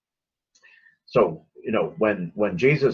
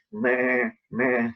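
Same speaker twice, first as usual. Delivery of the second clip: lamb bleat; 7 semitones higher than usual. Retold or imitated. Imitating a wobbly newborn lamb.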